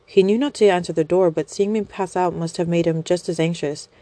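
plain reading voice

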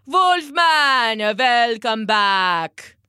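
forced cheer. Acted. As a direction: fake a welcome. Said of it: "forcing some cheer into his voice".

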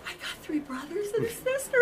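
retold high, hushed amazement; awed realisation. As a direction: squeal it under your breath in wonder. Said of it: "like that, you know".

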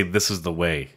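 a hint of a grave warrior; solemn catchphrase. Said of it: "They say".

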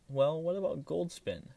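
a bright, pretend-naive question; feigned innocence. A narrator's reading. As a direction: act clueless on purpose. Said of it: "still pretending not to know".

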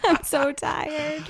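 mock exhausted whine.

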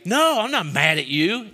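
high, loud, heated acted protest; mad-sounding denial. Worked-up speaker answering a friend, mock-angry denial. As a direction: deny being mad while sounding mad. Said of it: "it sounds like they're mad at you".

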